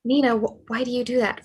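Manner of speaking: ordinary talk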